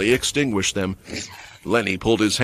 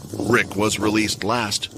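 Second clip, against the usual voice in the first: ordinary delivery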